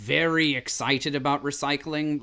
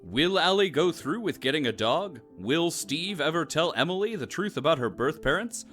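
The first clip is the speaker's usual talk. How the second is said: dramatic announcer. Acted. Announcer closes the episode.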